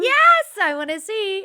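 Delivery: high, loud eager squeal; excited. Real, not acted. Co-host asked to see the wings, excited. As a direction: burst out, thrilled to get a look.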